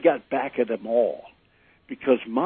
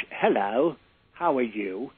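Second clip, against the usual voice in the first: deep British; 3 dB quieter than usual. Retold or imitated. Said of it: "he had a deep British".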